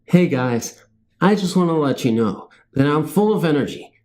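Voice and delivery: ordinary delivery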